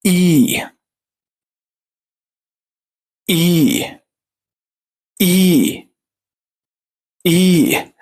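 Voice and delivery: long "إي" like the letter name "ee"; third E sound, kasra. Shown as a demonstration. Teacher models the third pronunciation, long "ee" on "إي". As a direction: hold "إي" as a pure long "ee", falling. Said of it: "مكسور بقى كسرة".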